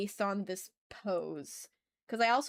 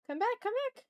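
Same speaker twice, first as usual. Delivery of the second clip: high, sing-song call to a lost image; plaintive much higher come-back call; playful calling out; 13 semitones higher, faster than usual. Real, not acted. Calling after the lost reference.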